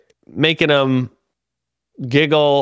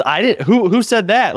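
indignant organizer; 8 semitones higher than usual. Retold or imitated. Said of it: "like, mad at me".

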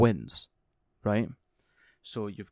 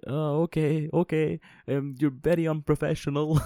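higher and louder, in a put-on prissy voice; mocking Punk caught off guard by the swearing, higher and louder; 6 semitones higher, 6 dB louder than usual. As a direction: play a stuffy, offended Punk, hammed up. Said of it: "And C M Punk's like".

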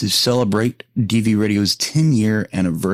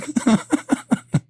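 high giggle. Real, not acted. Laughing at own tongue twister.

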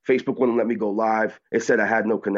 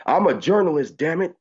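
higher, mock defiant yell; retold yelling back; 7 semitones higher than usual. Journalist answering an angry father, mock yell back. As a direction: push pitch up in retold defiance. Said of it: "I yell back and I said".

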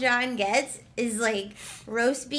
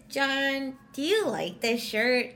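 not-liking-it tone. Shown as a demonstration. Woman cueing John to dislike the shirt, unenthused tone. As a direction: ask it unenthused, signalling the answer is no. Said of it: "If I went like this".

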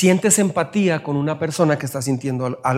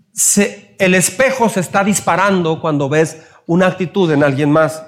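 ordinary talk, nothing marked

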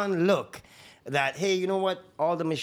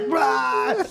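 higher, louder outraged bruh; mock shocked exclamation; 7 semitones higher, 5 dB louder than usual. Rookie protesting eyewash up his nose, loud mock. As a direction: blurt it as water hits your nose. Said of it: "And I was like".

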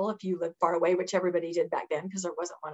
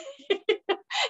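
a high incredulous laugh; 12 semitones higher than usual. Real, not acted. Woman recalling absurd accusation, high laugh.

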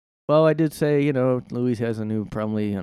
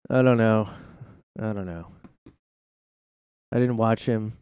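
ordinary talk, nothing marked

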